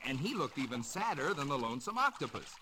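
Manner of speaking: ordinary delivery, nothing marked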